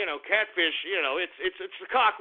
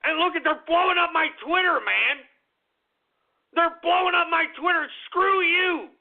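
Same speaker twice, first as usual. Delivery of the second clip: high-pitched indignant rant; indignant outburst; 7 semitones higher than usual. Real, not acted. Indignant rant at online backlash.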